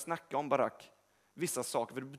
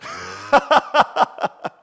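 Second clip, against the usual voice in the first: loud burst of laughter; 12 semitones higher, 16 dB louder than usual. Real, not acted. Laughs at finishing up.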